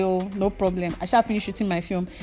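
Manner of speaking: ordinary talk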